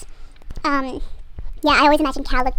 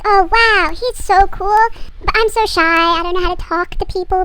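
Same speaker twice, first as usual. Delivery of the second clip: higher, louder mock-teen gushing; smitten gushing; 5 semitones higher, 6 dB louder than usual. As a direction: gush like a shy, smitten teenager. Acts out a shy, smitten teen.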